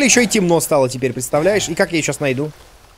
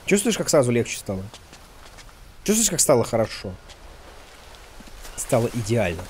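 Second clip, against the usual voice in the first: softer, lower relieved voice; relieved calm; 6 semitones lower, 9 dB quieter than usual. Real, not acted. Gamer relaxing after the character finally eats, relieved calm. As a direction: savour the relief.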